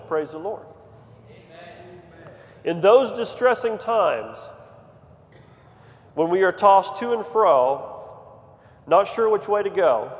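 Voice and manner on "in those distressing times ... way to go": louder, measured sermon delivery; preacher comforting the tossed and unsure, loud and measured; preaching cadence. Acted. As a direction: roll it out from the pulpit. Preaching rhythm.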